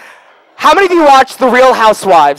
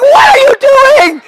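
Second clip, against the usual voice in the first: very high-pitched mock shriek; shocked outcry; 4 dB louder than usual. Comic reenacting shock at the dog being woken, high horrified squeal. Shocked reaction in retold story.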